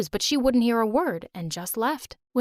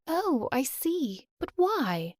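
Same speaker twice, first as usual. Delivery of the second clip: gentle coaxing; 5 semitones higher, slower than usual. Acted. Sally softly coaxing a child.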